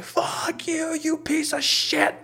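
strained, very high mock scream, held back in volume; retold yell. Yelling at brother from the stairs, drawn-out mock scream. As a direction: drag out a squeezed imitation yell. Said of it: "I just yell at him".

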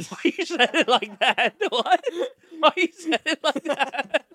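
laughing while asking; high squeaky laughing question. Real, not acted. Laughing at friend's delivery.

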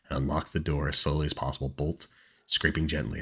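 usual speaking voice

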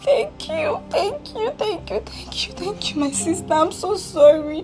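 crying thanks. Acted. Forgiven older sister thanking her younger sister, crying. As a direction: gush thanks through sobs.

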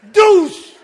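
loud imitated splash; mock splash noise; splash sound. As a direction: shout the splash of coffee. Throwing coffee, sound effect.

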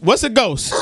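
normal talking voice

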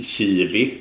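"kiwi" starting on tje, not K; soft tje-sound. Shown as a demonstration. Guest giving example of soft-vowel rule, tje in "kiwi". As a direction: say "kiwi" like "tjivi". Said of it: "så uttalar man ju ett K-ljud".

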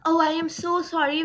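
ordinary delivery, nothing marked

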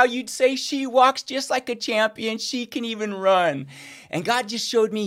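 ordinary talk, nothing marked

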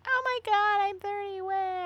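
high squealing Oh my God, hammed-up panic; mock Friends freakout. Friend mocking sitcom characters turning thirty, high hammed-up squeal. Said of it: "they're like".

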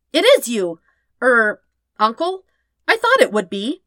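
high, loud, eager greeting; excited boy. Acted. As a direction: burst out with joy at finding him. Aang bounces off excitedly greeting uncle.

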